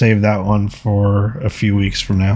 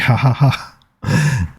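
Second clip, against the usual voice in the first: reading out a laugh; 3 semitones higher, much slower than usual. Retold or imitated. Reading a comment's laugh aloud.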